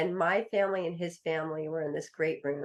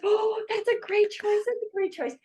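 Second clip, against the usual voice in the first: mock relieved relatives; forced enthusiasm; 4 dB louder than usual. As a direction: gush it like you don't mean it. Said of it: "everybody's like".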